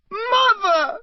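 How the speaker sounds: very high scream; desperate high scream. Acted. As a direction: scream for your mother. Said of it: "screamed the boy below".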